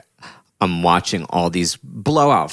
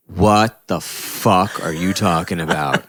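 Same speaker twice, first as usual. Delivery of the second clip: mock angry debtor; slower than usual. Retold angry debtor on phone.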